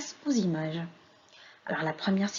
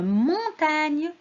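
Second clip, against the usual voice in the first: "montagne" slow and higher, "mon" held; sounding out; 5 semitones higher, 3 dB louder than usual. Shown as a demonstration. Teacher answers what the drawing shows, sounding it out. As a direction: stretch "montagne" in two long syllables.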